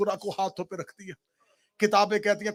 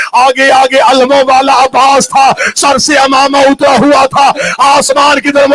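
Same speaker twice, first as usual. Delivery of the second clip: louder, higher wailing recitation of grief; rising majlis lament; 4 semitones higher, 20 dB louder than usual. Acted. Preacher mourning Sughra before the congregation, loud wailing. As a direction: build the lament to a full cry.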